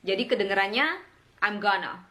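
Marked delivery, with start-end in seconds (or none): 1.4-2.0 'I'm gonna' as one chunk, 'um gonna'; short reduced 'I'm gonna'; quick and linked. Shown as a demonstration. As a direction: squeeze 'I'm gonna' together. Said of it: "Cepat dan disambung".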